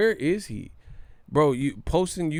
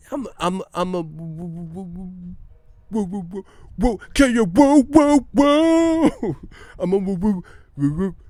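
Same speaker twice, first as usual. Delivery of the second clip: high stammer swelling into loud whoops; Harlem Nights stutterer; 6 semitones higher, 6 dB louder than usual. Retold or imitated. Acting out the movie dude with the stutter, whooping stammer. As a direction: get stuck on "woo", building to a shout. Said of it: "dude with the stutter".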